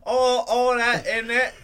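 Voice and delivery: loud, high British caricature; hammed-up British voice breaking into laughter; mock British accent. Friend plays Riley back from Europe, hammed-up British accent. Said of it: "he's already starting".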